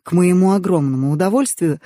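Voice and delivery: usual speaking voice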